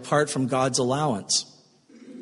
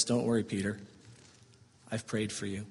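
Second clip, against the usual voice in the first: reassuring; 6 semitones lower, 11 dB quieter than usual. Acted. Jesus reassuring Peter.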